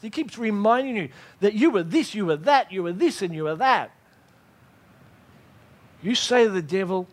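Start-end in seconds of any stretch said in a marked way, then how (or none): none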